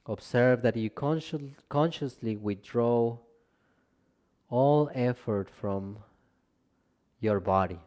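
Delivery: calm Yoga Nidra guiding voice with long pauses; guided meditation. Real, not acted. Teacher leading listeners into Yoga Nidra rest, calm guided meditation. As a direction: ease them into rest, gently, leaving space between phrases.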